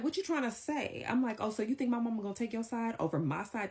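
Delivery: high, incredulous what-you-trying-to-say; indignant challenge. Retold or imitated. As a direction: confront him in disbelief. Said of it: "So I'm like".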